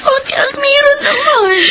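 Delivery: high, slow, crying plea; pleading sob. Acted. Sister pleads with Almira who wished to die, sobbing. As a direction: beg her through tears.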